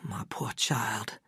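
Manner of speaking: doctor whispers pityingly to the patient, soft and slow; softly. Acted. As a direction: whisper it with pity, slowly. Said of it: "he said softly".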